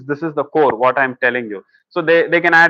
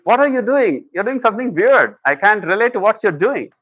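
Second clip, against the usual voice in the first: high, incredulous wife demanding answers; wife's exasperated voice; 6 semitones higher than usual. Retold or imitated. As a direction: play the baffled wife, pitched up. Wife confronts husband in story.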